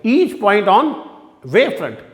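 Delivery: very slow, word by word dictation. Shown as a demonstration. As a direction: read it out slowly so students can write. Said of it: "English में लिखा हुआ है".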